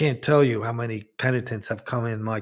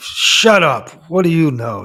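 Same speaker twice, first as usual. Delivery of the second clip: angry retold shout; 3 semitones higher, 8 dB louder than usual. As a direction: bark it at her like a furious husband. Husband snaps at wife.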